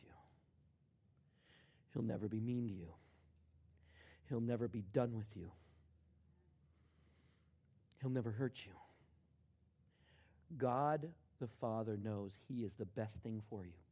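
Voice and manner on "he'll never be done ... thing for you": usual speaking voice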